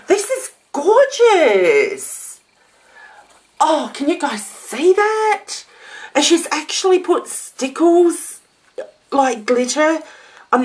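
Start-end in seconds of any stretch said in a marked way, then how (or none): 0.1-5.6 awed delight. Real, not acted. Admiring handmade album.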